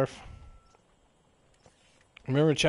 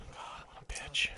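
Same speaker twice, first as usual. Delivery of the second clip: whisper; 9 dB quieter than usual. Real, not acted. Whispered muttering.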